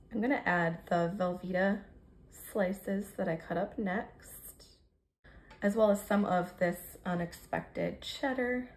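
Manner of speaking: usual speaking voice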